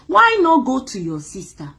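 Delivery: usual speaking voice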